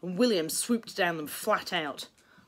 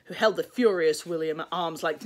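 ordinary delivery

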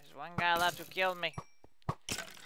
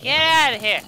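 loud, higher yelled order; frustrated yell at an enemy; shout get out of here; 10 semitones higher, 16 dB louder than usual. Real, not acted. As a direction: yell at the mob to go away. Yelling at mob.

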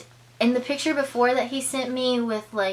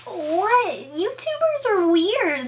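high, loud, stretched-out squeal of disbelief; giddy, can't believe it; excited disbelief; 9 semitones higher, 3 dB louder, slower than usual. Retold or imitated. Her retold reply to the YouTuber, giddy and higher. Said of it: "Out of excitement".

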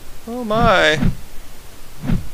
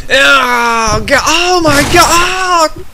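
high, drawn-out horrified shout; horrified yell; 6 semitones higher, 4 dB louder, slower than usual. Real, not acted. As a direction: cry out in real shock at the carnage. Horrified reaction to game gore.

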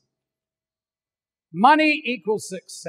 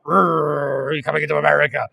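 gruff airport guard; 7 semitones lower than usual. Retold or imitated. US airport guard with a gun welcoming travellers, gruff. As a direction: lower growl of a stern armed guard. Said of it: "they've got a gun like".

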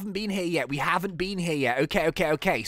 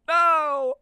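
loud, high-pitched cry; hammed-up anguished yell; melodramatic no; 11 semitones higher, 6 dB louder than usual. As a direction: shout in mock horror. Hero reacts to villain's threat.

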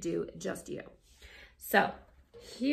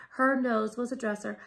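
ordinary delivery

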